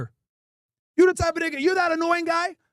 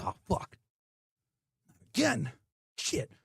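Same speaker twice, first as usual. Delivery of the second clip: disappointed mom; 7 semitones lower, 8 dB quieter, slower than usual. Retold or imitated.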